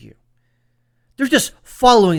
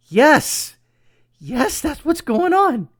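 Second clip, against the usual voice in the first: higher, eager burst of yes; excited agreement; 10 semitones higher, 4 dB louder, much slower than usual. Real, not acted. Host cheering the pastor's theory, excited yes. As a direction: jump in with thrilled approval, pitched up.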